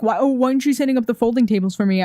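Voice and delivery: usual speaking voice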